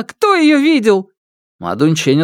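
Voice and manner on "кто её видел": very high, urgent woman's cry; frantic pleading. A narrator's reading. Mother seizes the intruder to ask about her daughter, frantic pleading. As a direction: plead desperately at the top of the range. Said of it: "умоляющим голосом".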